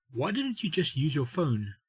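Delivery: ordinary delivery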